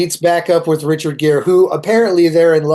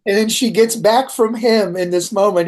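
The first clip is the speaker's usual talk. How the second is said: ordinary talk, nothing marked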